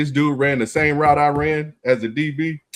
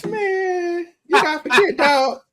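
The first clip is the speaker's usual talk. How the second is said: very high-pitched put-on voice; Tyrone Williams. Veteran warning a humbled newcomer, high-pitched imitated voice. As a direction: impersonate a teammate in a far higher voice, giving advice. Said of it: "Like Tyrone Williams told me one time".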